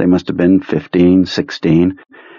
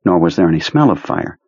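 usual speaking voice

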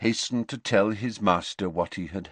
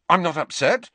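defensive denial; 8 semitones higher, 4 dB louder than usual. A narrator's reading. Harry denies defensively.

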